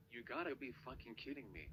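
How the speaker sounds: ordinary talk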